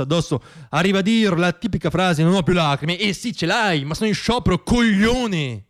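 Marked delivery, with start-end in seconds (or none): none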